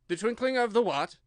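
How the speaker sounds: a king scolding his subject; loud reproving royal voice; stern king. A narrator's reading. King puzzled.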